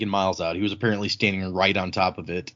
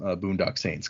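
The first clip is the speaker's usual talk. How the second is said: ordinary talk, nothing marked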